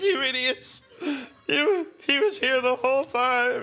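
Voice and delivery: high, laughing-crying voice of a sobbing friend; crying Usopp. Retold or imitated. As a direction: wail it through tears and laughter. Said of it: "He's like".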